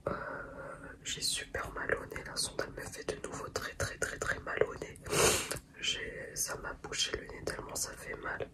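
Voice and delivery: soft whisper so the family sleeps; hushed whisper, barely voiced. Real, not acted. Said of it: "donc je chuchote parce que ma famille, elle dort".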